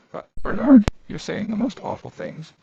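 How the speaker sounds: high, loud shocked cry; a loud, high burst of shock; shocked protest. A narrator's reading. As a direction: gasp it out, scandalised.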